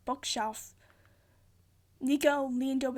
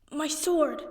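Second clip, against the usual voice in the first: loud sudden realization, higher; alarmed cry; 3 semitones higher, 6 dB louder than usual. A narrator's reading. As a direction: blurt it out in alarm. Percy realizing, dangling over pit.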